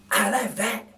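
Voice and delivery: lightly voiced gruff burglar, slowed; a hint of a pleased thief eyeing loot; Burglar Bill voice. Narrator voices Bill admiring a toothbrush, lightly voiced. Bill's voice in read story.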